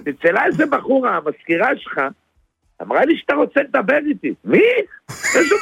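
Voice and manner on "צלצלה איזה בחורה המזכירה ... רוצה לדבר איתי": ordinary delivery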